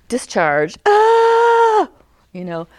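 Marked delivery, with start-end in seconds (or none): 0.9-1.9 high, loud mock child wail; child's cry. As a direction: cry out like a hurt kid.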